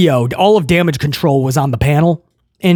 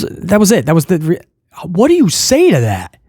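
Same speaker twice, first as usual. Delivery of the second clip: ordinary talk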